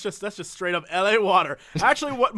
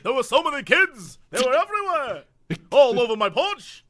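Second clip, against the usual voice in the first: put-on grumpy old man; 5 semitones higher, 4 dB louder than usual. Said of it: "You're like".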